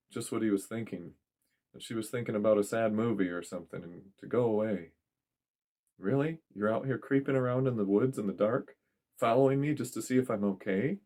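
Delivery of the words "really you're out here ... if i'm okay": woman character. Acted. Woman's voice in story.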